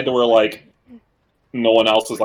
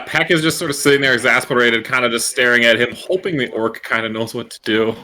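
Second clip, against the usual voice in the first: ordinary talk, nothing marked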